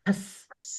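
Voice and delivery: খস breathed without voice, just a soft k-s; whispered ks. Shown as a demonstration. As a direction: whisper খস as a light ks, no kh. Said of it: "খ উচ্চারণ করা যাবে না".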